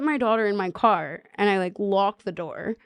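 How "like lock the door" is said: ordinary delivery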